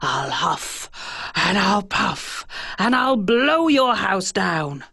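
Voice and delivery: slow, drawn-out big bad wolf. A narrator's reading. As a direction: menace the pig slowly as the wolf. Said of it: "said the wolf".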